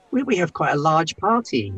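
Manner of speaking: normal talking voice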